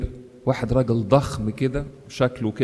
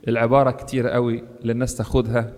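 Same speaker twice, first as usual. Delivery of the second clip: ordinary delivery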